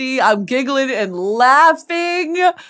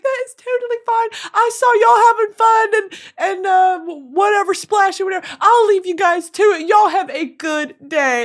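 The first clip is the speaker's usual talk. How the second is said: high, sweet, gushing voice; sweet fan; 9 semitones higher than usual. Retold or imitated. The fan kindly leaving them alone, high and sweet. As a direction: let them off kindly, chirpy. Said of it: "She's like".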